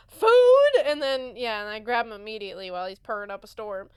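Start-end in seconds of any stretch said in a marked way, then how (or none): 0.1-0.8 high, loud excited "Food?"; the cat perks up at the word food; hungry cat voice, much higher and louder; eager cat. Retold or imitated. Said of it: "and he's like".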